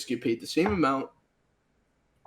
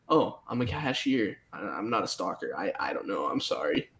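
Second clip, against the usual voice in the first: apologetic cashier; 7 semitones lower, 4 dB quieter than usual. Retold or imitated. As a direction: shrug it off apologetically. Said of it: "if they ask you questions".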